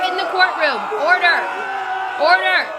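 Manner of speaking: loud order in court; shouting. Acted. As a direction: bark order at the room. Judge banging for order.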